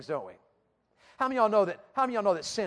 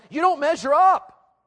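loud, high, slow accusing taunt; sin's raised, condemning voice; 8 semitones higher, 10 dB louder, slower than usual. Acted. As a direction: accuse them loudly, voice raised. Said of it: "here's what sin says about you".

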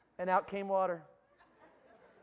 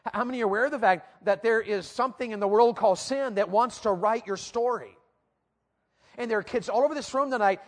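ordinary talk

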